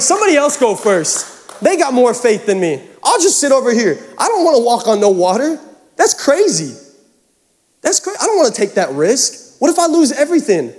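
high-pitched, hammed-up protest; reluctant doubter. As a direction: play someone refusing to leave the boat. Acts out a reluctant boat-sitter.

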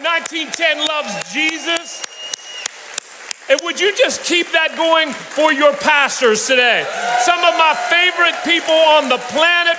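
high, shouting crowd hype; rally speech. Real, not acted. Guest rallies crowd to make noise.

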